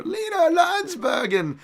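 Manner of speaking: high, loud hammed-up name call; mock announcer shout. Host running through fight picks, hamming up a high shouted name. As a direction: belt out the name like a ring announcer.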